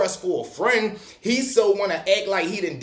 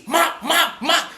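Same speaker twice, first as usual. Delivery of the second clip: mock punch sounds; 10 semitones higher, 3 dB louder, much slower than usual. Imitating punches landing on him.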